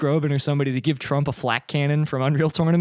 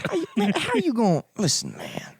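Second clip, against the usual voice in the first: hammed-up stand-up routine; mock stand-up comedian; 4 semitones higher, 3 dB quieter than usual. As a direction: start in pretend outrage, then drop down confidingly.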